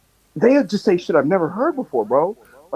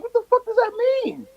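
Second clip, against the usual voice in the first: high-pitched exasperated bafflement; baffled outburst. Retold or imitated. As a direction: voice leaps up in mock disbelief. Acting out his baffled reaction.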